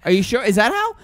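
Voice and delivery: high, doubting "is that how" voice; mock clueless lady. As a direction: play a baffled woman who won't trust the answer. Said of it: "Now what?".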